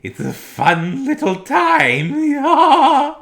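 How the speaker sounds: put-on very high, loud, very slow lisping old comedian; high falsetto impersonation. Shown as a demonstration. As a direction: squeeze it up into a giddy falsetto. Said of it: "try and do impersonations".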